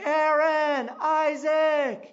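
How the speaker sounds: loud, high calling of names; a father calling out through the forest. Retold or imitated. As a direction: call them across the forest. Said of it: "I was coming calling".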